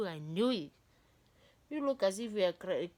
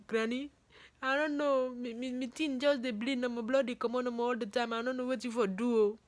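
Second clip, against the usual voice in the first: higher, panicked Nigerian Pidgin to Granny; Nigerian Pidgin plea; 6 semitones higher, 3 dB louder than usual. Retold or imitated. Retold plea to grandma in Pidgin.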